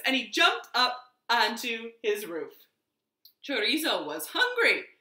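usual speaking voice

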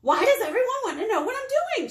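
high, fast, exasperated shout; grumpy bird's hammed-up outburst. A narrator's reading. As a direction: blow up at all the nosy questions. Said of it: "shouted Bird".

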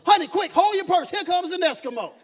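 mock panic to the wife; alarmed husband. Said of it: "walking along with his wife and say".

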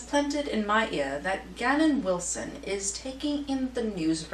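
put-on British accent, lilting gossip-columnist read; Lady Whistledown accent. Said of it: "pardon the accent that comes out".

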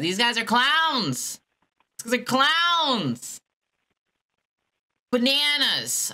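high, slow mock singsong. Caller mocking the agents while on hold, high and slow. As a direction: taunt them with a drawn-out singsong.

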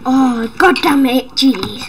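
ordinary delivery

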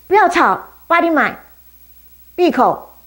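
high, loud hushing rebuke; scolding. Acted. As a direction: shut him up sharply. Crowd scolding the beggar.

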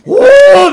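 loud, high braying whoa; mule bray. Retold or imitated. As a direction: let out a mule's bray, full voice.

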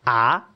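"ah" rising like a question; second tone. Shown as a demonstration. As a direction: slide "ah" upward as if asking. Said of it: "is rising".

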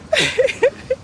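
high-pitched giggle; laugh. Real, not acted. Laughing at own confession.

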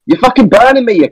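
sudden high-pitched yell of pain; yelping as the hit lands. Real, not acted. Gamer yelling at a teammate burning him. Being killed in the raid.

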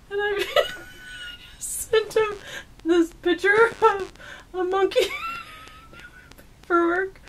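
high-pitched giggling through words; can barely get it out for laughing. Real, not acted. Cracking up telling monkey story.

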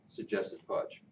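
plain reading voice

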